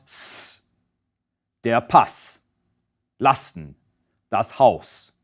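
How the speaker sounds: "Pass", "Lasten", "Haus" with a sharp hissing S; crisp unvoiced S; voiceless S. Shown as a demonstration. Teacher demoing the voiceless double S. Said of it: "pronounced as a voiceless S".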